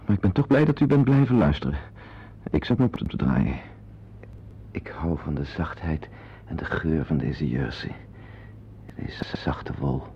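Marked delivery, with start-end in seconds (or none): 4.7-10.0 low, hushed and slow, nearly breathed; murmured delight in the wool; hushed savouring. Acted. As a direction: linger softly over the soft wool. Man savouring her soft sweater.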